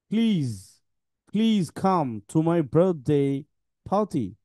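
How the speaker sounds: very slow, word by word; slow model reading. Shown as a demonstration. Teacher models sentences for pupils to read back, very slowly. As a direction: read each sentence slowly and deliberately, pausing between phrases. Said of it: "আমি একটু পড়াই দেই".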